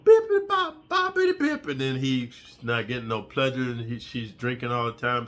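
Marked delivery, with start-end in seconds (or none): 0.0-1.7 very high loud squeaky beep; silly beep noise. Retold or imitated. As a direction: chirp it like a toy. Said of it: "He's going".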